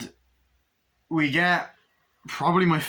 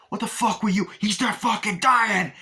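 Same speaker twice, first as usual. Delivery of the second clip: high, loud furious shouting; angry yell; 10 semitones higher, 4 dB louder than usual. Retold or imitated. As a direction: yell at him in outrage. Retold argument over dying man.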